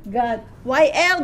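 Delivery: ordinary talk, nothing marked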